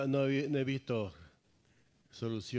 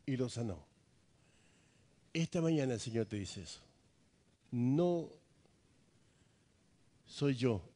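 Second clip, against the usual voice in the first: normal talking voice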